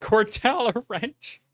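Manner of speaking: echoing the name in disbelief, high; high disbelieving question; incredulous. Real, not acted.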